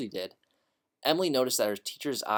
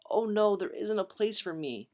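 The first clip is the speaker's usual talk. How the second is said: put-on little girl voice; 10 semitones higher than usual. A narrator's reading.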